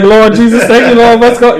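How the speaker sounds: ecstatic praise. Retold or imitated. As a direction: shout praise to the Lord. Acting out worship ecstasy.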